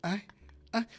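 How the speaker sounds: ascending hushed mock moans; rising mock moans. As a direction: let little cries climb in pitch. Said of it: "ella va como que".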